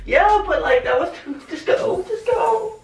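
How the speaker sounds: high shivering plea; teeth chattering. Real, not acted. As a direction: beg to hurry while freezing. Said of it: "I'm cold".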